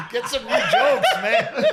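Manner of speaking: high, loud laughing son's jab; teasing son impression cracking up; laughing mock quote. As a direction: squeal it out through laughter. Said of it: "He's like".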